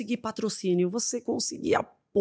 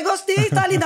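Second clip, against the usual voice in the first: eager reassurance; 10 semitones higher than usual. Retold or imitated. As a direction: insist she looks lovely. Reassuring her about the dress. (elogio agudo e insistente)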